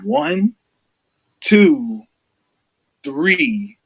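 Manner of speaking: slow, emphatic count, each number set apart; re-enacted three count. Fan retelling the referee's pinfall count, slow and emphatic.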